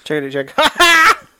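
high, slow, giggling through it; laughing mic check. Pretend. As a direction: stretch it out high while laughing.

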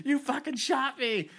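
drawn-out indignant complaint; imitating a shot soldier's outrage; slow, high mock outrage. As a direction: drag it out in disbelief. Wounded soldier quote, slowed.

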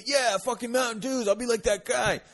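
mock jittery hype after an energy drink; hyped up. Comic before stand-up, hyped on Mountain Dew, pumped. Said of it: "I thought it would be good".

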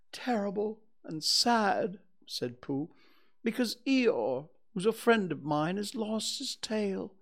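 high-pitched bear explaining sad news; Pooh, downcast. Acted. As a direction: voice a sorry little bear asking for help.

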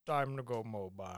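low, soft villain impression; Tom Hardy. Co-host answers with another actor impression, low and quiet. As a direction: drop it down into a muffled film-character voice. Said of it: "How many Tom Hardy impressions".

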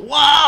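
high startled Wow; mock yelp. Witch King gets stabbed.

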